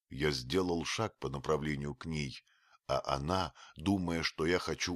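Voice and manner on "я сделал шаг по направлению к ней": plain reading voice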